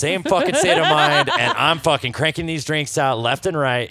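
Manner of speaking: loud laughing rant. Real, not acted. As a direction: laugh through the boast. Laughing about cranking out drinks.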